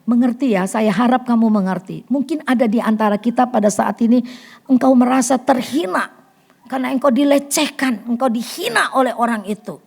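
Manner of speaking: ordinary talk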